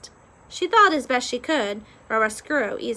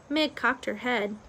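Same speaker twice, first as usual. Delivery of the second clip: usual speaking voice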